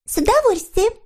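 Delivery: put-on very high, sweet girlish character voice; eager little girl figure. A narrator's reading. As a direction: agree gladly in a high little voice. Snork Maiden voiced in story. (тоненький девичий голосок)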